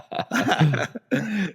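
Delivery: a high burst of real laughter; amused laugh. Real, not acted. Guest laughing about the flat earth package he sent, real laugh. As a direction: laugh at your own prank. Laughs at his own eBay prank.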